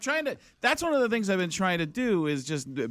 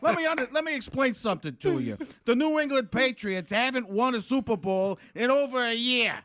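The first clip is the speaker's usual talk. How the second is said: higher-pitched Boston accent; 7 semitones higher than usual. Shown as a demonstration. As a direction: put on a comic Boston accent. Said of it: "that's Boston".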